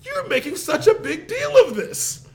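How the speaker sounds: high-pitched wife imitation; exasperated wife. Wife complaining about his fuss, imitated in high exasperation. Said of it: "And she was like".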